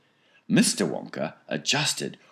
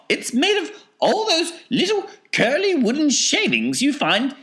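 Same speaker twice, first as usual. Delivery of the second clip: very high, eccentric falsetto rant; indignant Wonka; 6 dB louder than usual. A narrator's reading. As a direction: scold in a piping, theatrical voice.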